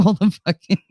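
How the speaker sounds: normal talking voice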